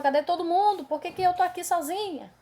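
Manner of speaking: retold frightened question; anxious pleading question. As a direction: ask where everyone went, a little alarmed.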